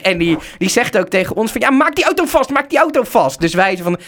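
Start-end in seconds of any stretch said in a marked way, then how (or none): 1.6-3.4 high, urgent repeated order; mock frantic tow-man's command; imitated stressed driver. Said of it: "honderd keer meer in de stress".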